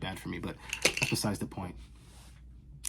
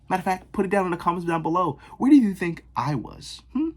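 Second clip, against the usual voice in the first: quick, higher, louder playful challenge; mock challenge; 9 semitones higher, 8 dB louder than usual. Vlogger challenges viewers to comment, fast, high and loud. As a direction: egg the viewers on, fast and bright.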